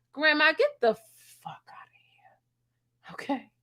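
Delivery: slow unvoiced whisper; whispered taunt. Receptionist telling old patient to leave, slow whisper.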